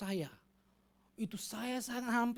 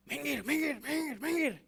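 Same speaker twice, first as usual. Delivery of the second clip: angry shout; 9 semitones higher, 6 dB louder than usual. Retold or imitated. Shouting at the other driver.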